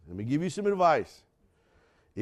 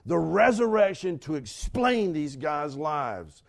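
usual speaking voice

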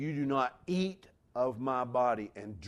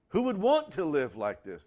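higher, louder despairing cry; anguished cry; 4 semitones higher, 5 dB louder than usual. Retold or imitated. Preacher voicing overwhelmed Christians, a loud anguished cry. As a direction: cry out from the end of your rope. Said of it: "they say".

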